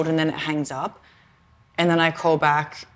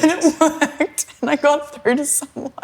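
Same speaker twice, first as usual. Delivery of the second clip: laughing; 9 semitones higher than usual. Real, not acted. Laughing while telling the payoff.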